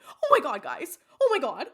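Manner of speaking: loud, high mock-shocked YouTuber; hammed-up freak-out for the camera; exaggerated shrieky vlogger impression; Shane Dawson. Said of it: "make her sound like Shane Dawson".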